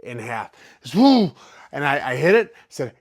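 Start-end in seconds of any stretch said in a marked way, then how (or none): 0.9-1.3 sudden high yelp of pain; loud sharp cry as it hurts. Retold or imitated. Foot folds in half.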